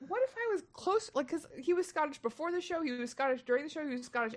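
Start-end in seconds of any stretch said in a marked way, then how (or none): 0.1-1.1 sharply higher, alarmed squeak; freaked-out question. Retold or imitated. Comic retelling his alarm at the fake Scot, high and panicked.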